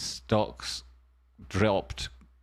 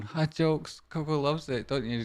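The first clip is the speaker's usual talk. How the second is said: ordinary delivery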